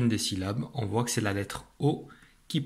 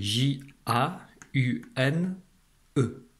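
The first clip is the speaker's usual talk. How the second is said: J A U N E spelled slowly letter by letter; spelling out; much slower than usual. Shown as a demonstration. Teacher spelling jaune letter by letter. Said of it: "Voici l'écriture du mot jaune".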